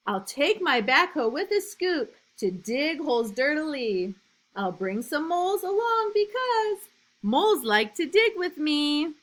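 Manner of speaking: put-on reading to children. A narrator's reading. Teacher reading a picture book to new kindergartners, lively and loud. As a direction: read it big and bouncy for little kids.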